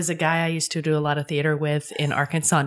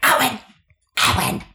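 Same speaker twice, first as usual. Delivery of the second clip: imitating the mom; 5 semitones higher, 4 dB louder than usual. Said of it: "the mom from Throw Mama from the Train".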